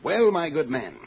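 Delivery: a light fake upper-class English accent; put-on English accent. A hint of posh English put on for a visitor. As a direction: crook posing as an Englishman, lightly done. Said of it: "Don't forget your accent".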